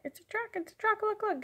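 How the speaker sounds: put-on high-pitched excited little kid; excited child. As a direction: imitate a small boy thrilled by trucks. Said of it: "he'd be like".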